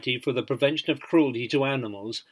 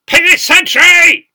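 a huge, slow, drawn-out bellow, much higher and louder; parade-ground roar; 16 dB louder, slower than usual. A narrator's reading. Corporal summons the sentry across the yard, roaring. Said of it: "He turned round and roared".

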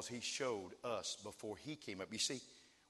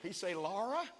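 higher, lightly acted puzzled question; imagined hopeful questioner; 9 semitones higher, 6 dB louder than usual. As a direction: ask it with a comic rising lift on the name. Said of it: "there's some guys down there said".

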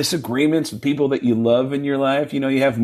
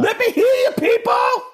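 screaming-high stage shout. Retold or imitated. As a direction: whip up the crowd in a piercingly high rock yell. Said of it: "do your impression of me".

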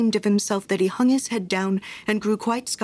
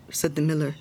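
ordinary delivery, nothing marked

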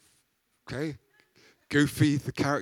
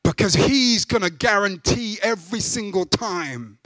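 loud, high, impassioned sermon; preaching; 5 semitones higher, 5 dB louder than usual. Acted. Preacher urging the church, loud and high. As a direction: preach it with full force to the congregation.

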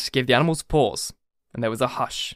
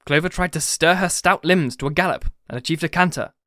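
plain reading voice

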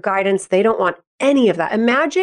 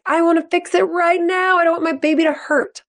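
higher, pleading fix-it parent voice; anxious fixing parent; 10 semitones higher than usual. Retold or imitated. Mom who wants to spare her baby hurt, pleading and high-pitched. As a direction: play the mom desperate to make it better. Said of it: "have a tendency to be like".

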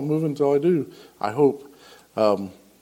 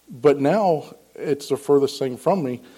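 ordinary talk

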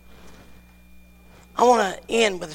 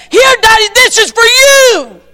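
put-on much higher, loud, eager child; little girl voice; 14 semitones higher, 16 dB louder than usual. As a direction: offer the gift like an excited small girl. Little daughter gives her gift.

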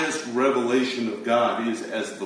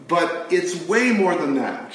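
ordinary talk

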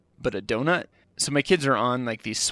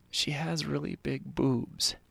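quiet, slow, a bit sheepish; hushed confession; 8 dB quieter, slower than usual. Retold or imitated. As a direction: drop the voice and say it slowly, embarrassed. Confiding to wife, hushed.

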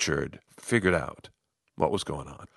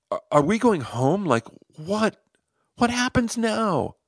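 high, rising confused questions; lost and unsure what comes next; bewildered; 11 semitones higher than usual. Retold or imitated. Actors confused on stage.

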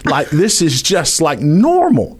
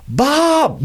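high calling yell, pitched way up; high mock yell of a name; 12 semitones higher than usual. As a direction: call him out of the house from the yard. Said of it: "Dad just starts yelling".

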